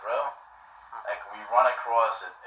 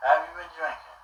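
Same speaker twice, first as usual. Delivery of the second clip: higher-pitched imitation of the grilling homeowner; suspicious homeowner; 6 semitones higher than usual. Homeowner grilling the soaked boy, in a raised mimicking voice. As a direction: ask it up high, mimicking a nosy, baffled man. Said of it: "And he goes".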